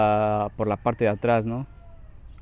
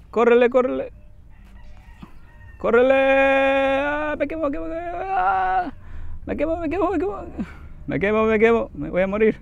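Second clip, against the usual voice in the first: panicked screaming; 11 semitones higher than usual. Real, not acted. Gamer screaming while his burning character flees. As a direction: scream and run for your life.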